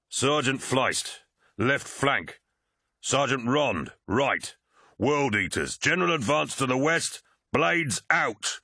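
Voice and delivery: rally the troops to advance; captain commanding his warriors to advance, shouting; barked battle orders. A narrator's reading.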